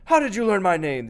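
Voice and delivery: high, loud furious roar; raging. Acted. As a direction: rage like an outwitted ogre. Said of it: "the Oni raged".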